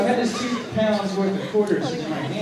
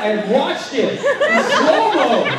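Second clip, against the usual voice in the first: louder, slow, laughing delivery; excited slowed-down retelling; 10 semitones higher, 6 dB louder, slower than usual. Real, not acted. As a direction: stretch it out in laughing awe. Recounting slow-motion impact.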